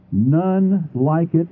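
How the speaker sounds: None like it, slow with none stressed; slow stressed repetition; emphatic repeat. Shown as a demonstration. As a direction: hit none slowly and firmly. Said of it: "Please emphasize this".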